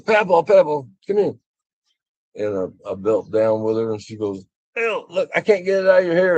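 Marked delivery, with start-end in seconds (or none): 0.0-1.3 put-on high, louder child's call; pitched-up little kid calling out; four-year-old granddaughter. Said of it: "my four-year-old granddaughter". 4.8-6.4 put-on high-pitched, quick little-girl voice; squeaky-high retold kid complaint; four-year-old granddaughter. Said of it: "my four-year-old granddaughter".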